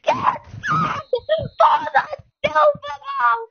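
high, loud yell of triumph; excited yell. Real, not acted. Gamer yelling at the winning play, loud excited yell. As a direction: shout it like the title is on the line. Big play for the Super Bowl.

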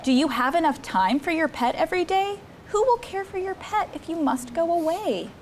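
ordinary talk

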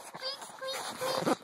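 high, soft squeaky mouse voice; tiny mouse squeak. Acted. Parent squeaking like the mouse for baby. Mouse noise while reading.

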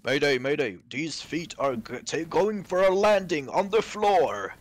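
loud mock pilot distress call, rising; bracing for a crash. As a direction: shout a crash landing like a pilot.

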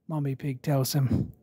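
usual speaking voice

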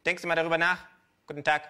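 ranting kangaroo. Acted. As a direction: spit out every greedy demand. Känguru leaves bank.

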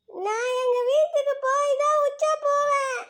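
high, slow stubborn refusal; stubborn kid. Acted. Child refuses the teacher's order to sleep.

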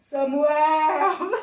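loud, drawn-out booming call; =big mighty calling voice; voice of God. Acted. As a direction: summon grandly, holding the last syllable. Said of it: "a voz chamou mais uma vez".

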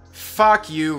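ordinary talk

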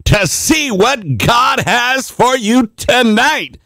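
high, drawn-out preacher's excitement; charged up. Real, not acted. Radio pastor opening his late-night prayer show, charged up. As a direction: stretch the words out high, pumped for tonight. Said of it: "I'm charged up, and I'm excited".

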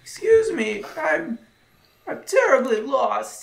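put-on high, frail old beggar voice; old blind man voice. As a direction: play a lost, helpless old blind man. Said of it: "doing the whole blind guy thing".

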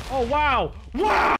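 high, loud astonished wow; stunned wow. Real, not acted. Gamer watching creepers explode, high loud astonished wow. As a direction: gasp it out in amazement.

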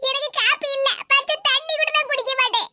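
fretting over her pregnant daughter-in-law; worried grandmother. Acted. Grandmother worrying to Maragatha in hospital, fretful.

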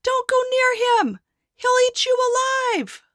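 high, loud warning yell. Acted. Townsfolk yelling at the boy not to approach. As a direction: shout a desperate warning. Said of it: "People yelled".

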